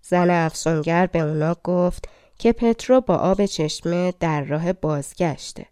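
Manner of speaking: plain reading voice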